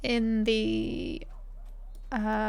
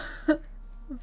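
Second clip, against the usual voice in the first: breathy giggle; 5 semitones higher, 4 dB quieter than usual. Real, not acted. Laughing at own accent.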